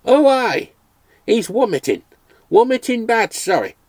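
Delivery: a relieved old Irishman, lightly voiced; Irish brogue. Mulligan finally understands.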